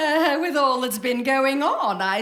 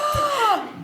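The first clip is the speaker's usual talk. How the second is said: gasp; 12 semitones higher than usual. Acted. Shocked at child's remark.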